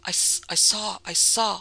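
stammering through chattering teeth; terrified, teeth chattering; teeth chattering in terror. A narrator's reading. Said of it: "his teeth chattering and rendering speech".

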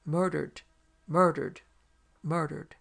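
loud, mournful echoing shouts; hollow shouted echo of a phantom; lugubrious shouts. A narrator's reading. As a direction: cry it out like a ghostly echo. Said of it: "answered for them in lugubrious shouts".

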